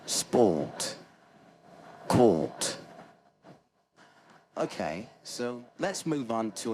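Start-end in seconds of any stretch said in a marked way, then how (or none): 0.1-2.8 loud, spaced British "sport" and "court", no R; British accent. Shown as a demonstration. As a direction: model "sport" and "court" with silent R, British style. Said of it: "So you want to say".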